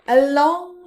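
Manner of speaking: plain reading voice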